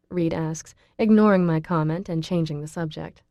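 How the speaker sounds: plain reading voice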